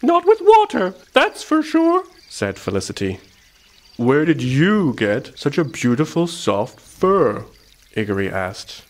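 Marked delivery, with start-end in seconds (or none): 0.0-2.0 very high, loud little mouse; field mouse voice. A narrator's reading. Field mouse answers the hedgehog, squeaky and firm. 4.0-7.5 slow, lingering wonder; admiring question. A narrator's reading. Hedgehog asks mouse about her fur, admiring.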